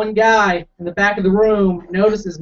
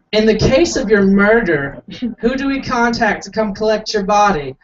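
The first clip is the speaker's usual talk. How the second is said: higher, smug official voice; smug accent; 4 semitones higher than usual. Retold or imitated. Mock jail officer asking the booking question, smugly. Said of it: "didn't say it with a smug accent".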